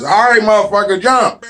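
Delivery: a hammed-up pilot shouting over the engine; pushy pilot. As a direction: bark at him to jump out. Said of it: "The pilot looks back at me and says".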